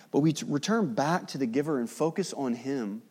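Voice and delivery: normal talking voice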